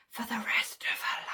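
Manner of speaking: pointed stage whisper; whispered relish. Real, not acted. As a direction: whisper it for dramatic effect. Savours villain's sentence, repeated whispered.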